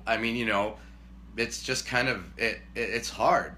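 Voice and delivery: ordinary talk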